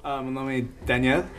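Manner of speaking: English-speaker accent in French; careful learner French with English accent; foreign accent in French. Real, not acted.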